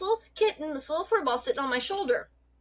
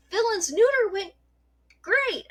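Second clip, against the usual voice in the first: high, bright-voiced cheerful report; mock the oblivious tech's sunny tone; high chipper receptionist voice; chirpy vet tech; 10 semitones higher, 3 dB louder than usual.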